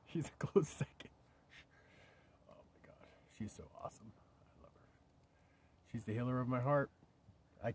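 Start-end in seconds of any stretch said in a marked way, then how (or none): 2.5-4.9 soft, voiceless whisper of love; tender whisper. Real, not acted. Grandpa gushing over granddaughter, whispered. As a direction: say it like a secret you can't hold in.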